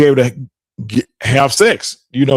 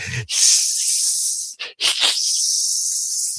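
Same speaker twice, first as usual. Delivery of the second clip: long drawn-out breathy hissing; imitated creature hiss in long bursts; Sleestak hiss; 5 semitones lower, 9 dB quieter than usual. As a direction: hiss like an approaching lizard creature. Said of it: "you could hear 'em coming 'cause they'd be like".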